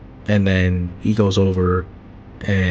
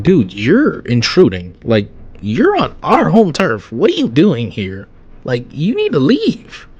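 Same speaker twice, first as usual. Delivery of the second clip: telling off; 6 semitones higher, 4 dB louder than usual. Retold or imitated. Ten Tails telling them off.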